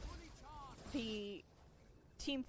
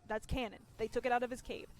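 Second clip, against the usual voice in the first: ordinary talk, nothing marked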